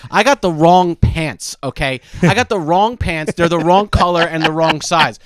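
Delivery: spells it out slowly to a dumb bot; exasperated, slow, word by word; slow emphatic complaint. Retold or imitated. Customer slowly spelling it out to chatbot, exasperated.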